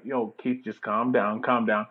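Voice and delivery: hushed, low imitation of a girlfriend; calming. Girlfriend warning Keith at the grill, quietly calming. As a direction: talk him down under your breath. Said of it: "looking at me like".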